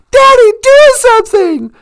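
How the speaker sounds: hammed-up panicked cry, high and loud; a little girl shrieking for help; cries out. The girl begs her dad as they go over the falls, mock panic. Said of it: "she cries out".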